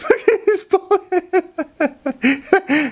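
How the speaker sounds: high, breathless giggling through words; can barely speak for laughing. Real, not acted. Laughing at bald head.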